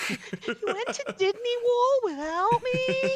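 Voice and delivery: put-on high, slow whining kid voice; mock whiny girl. Streamer voicing Maya, whining about being left out. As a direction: whine it like a pouting little sister. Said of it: "You went to, you went to M E O?".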